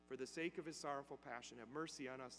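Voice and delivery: quiet praying. Retold or imitated. Priest prays the rosary beside the dying man, quiet praying. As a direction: recite it quietly, low and soft. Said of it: "he quietly starts praying".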